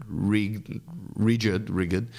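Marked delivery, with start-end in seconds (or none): none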